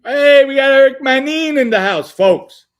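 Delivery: loud, high announcer-style holler; excited shout. Real, not acted. Host announces Eric has arrived, shouting with excitement. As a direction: shout a big welcome to the crowd. Host hails a guest joining live.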